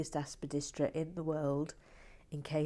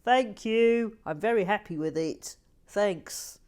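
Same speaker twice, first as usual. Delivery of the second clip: louder, higher cheerful thanks; loud happy mock thanks; quoting John Perry; 5 semitones higher, 8 dB louder than usual. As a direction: gush your thanks like a proud fan. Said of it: "he said".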